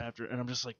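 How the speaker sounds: usual speaking voice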